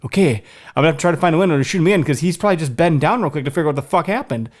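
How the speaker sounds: very fast, racing retold thinking; rushed inner thoughts. Hunter retelling his frantic plan to find a shot. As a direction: rattle it off like your mind is racing. Hurried thoughts during hunt.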